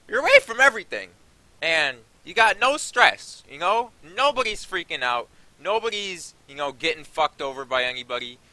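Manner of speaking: high and loud, building passion; fired-up rant. Real, not acted. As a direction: preach the joys of escape, worked up. Ranting about vacation freedom.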